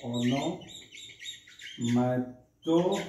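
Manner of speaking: ओनोमैटो stretched out very slowly; slow pronunciation. Shown as a demonstration. Teacher slowly introducing the term ओनोमैटो to students. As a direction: drag out ओनोमैटो syllable by syllable. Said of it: "अगला हमारा जो figure of speech है".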